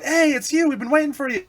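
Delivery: high-pitched imitation of an eager crowd; mock excited greeting. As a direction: greet him like a long-awaited guest, hammed up. Crowd greeting him, retold.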